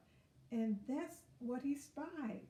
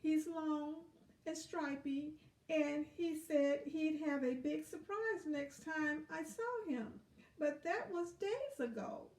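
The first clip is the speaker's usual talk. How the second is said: pleading ladybug; 4 semitones higher than usual. Acted. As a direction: earnestly ask for help. Ladybug voiced in story.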